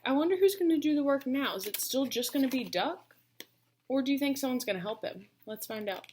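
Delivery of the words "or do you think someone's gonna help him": ordinary delivery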